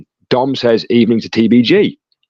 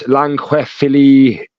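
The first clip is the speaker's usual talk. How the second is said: slow, laboured attempt at a Welsh accent. Shown as a demonstration. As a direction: stumble through it in a mock Welsh accent. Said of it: "That's my Welsh accent for you".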